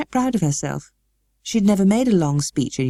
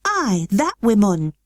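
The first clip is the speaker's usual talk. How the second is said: put-on a girl trying out Yorkshire, slow; careful learner's Yorkshire; slow, higher girl's mock Yorkshire; Yorkshire attempt; 5 semitones higher, slower than usual. A narrator's reading. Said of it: "So she spoke a little now".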